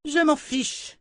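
very high-pitched, cold retort; seer's icy indifference; cold dismissal. A narrator's reading. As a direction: drop it dismissively, high and disdainful. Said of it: "lâcha froidement la voyante".

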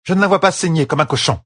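high-pitched irritated retort; grumbled. A narrator's reading. Séonide dismisses Perrine's worry for his wife, grumbling. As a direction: grumble it as an impatient healer. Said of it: "grogna Séonide".

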